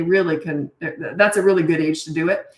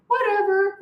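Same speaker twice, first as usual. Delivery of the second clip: sweet little voice; 13 semitones higher than usual. Retold or imitated. Mom trying to brush off her cheeky kids, tiny sweet voice. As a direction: squeak it out like a sweet gentle mom. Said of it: "She just had a sweet little voice".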